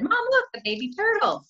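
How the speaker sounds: put-on high-pitched excited kid voice; excited child. Teacher acting out her daughter spotting a turtle, excited. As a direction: squeal it like a thrilled little girl. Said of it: "And then I said".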